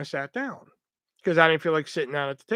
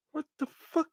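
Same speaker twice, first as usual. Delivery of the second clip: high, hushed, incredulous mutter; quietly baffled, pitched way up; baffled disbelief; 11 semitones higher, 6 dB quieter than usual. Retold or imitated. Baffled reaction to coworker's rudeness.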